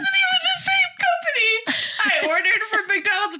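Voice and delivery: very high, squealing through laughter; laughter squeezing the words high; helpless laughing confession. Real, not acted. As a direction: confess it while cracking up. Confessing her own silly mistake.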